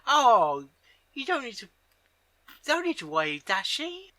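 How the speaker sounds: high, sugary fake comfort; sweet creepy Pinkie. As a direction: coo it like a sweet-talking killer. Voicing Pinkie soothing Rainbow Dash.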